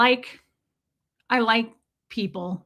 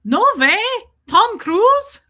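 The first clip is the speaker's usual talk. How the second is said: mock amazed squeal, much higher and louder; 11 semitones higher, 9 dB louder, slower than usual. Shop lady amazed at Tom Cruise link, high and loud. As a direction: imitate a delighted gasp of disbelief.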